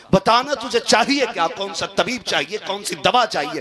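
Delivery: pleading cry. Retold or imitated. Pleading father voiced in story.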